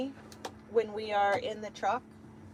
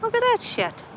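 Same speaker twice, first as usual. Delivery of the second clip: excited; 12 semitones higher, 6 dB louder than usual. Real, not acted. Sees horses outside.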